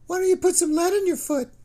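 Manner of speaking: mock him in a shrill-high teasing voice. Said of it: "his voice high and mocking".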